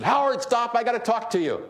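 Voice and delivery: mock yell. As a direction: call after him across the airport. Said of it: "this flight attendant yelled at me".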